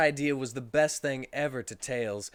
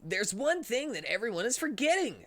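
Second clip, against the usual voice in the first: higher, put-on hero voice; Sonic character voice; 9 semitones higher than usual. Narrator voicing Sonic to friends, higher hero voice. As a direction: voice Sonic rallying the group.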